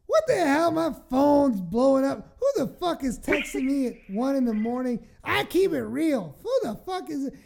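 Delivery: put-on loud, high drunken rant; drunk outburst. Friend mocking her drunk self, loud angry rant. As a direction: rage at the buzzing phone. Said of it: "And you're like".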